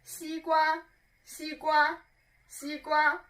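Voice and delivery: 西瓜 said high and level each time; flat high first tone, three repetitions. Shown as a demonstration. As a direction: sustain a steady high pitch on 西瓜. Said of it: "So that is first tone".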